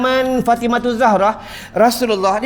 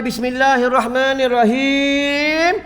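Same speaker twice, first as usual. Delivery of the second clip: slow recitation; 3 semitones higher, slower than usual. Acted. Prophet recites over the stone.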